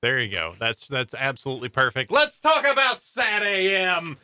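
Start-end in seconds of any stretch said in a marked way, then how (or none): 2.1-4.2 big, drawn-out show-opener call; higher, louder and slowly stretched out; announcer shout. Pretend. Host launching the show's topic, hyped announcer call.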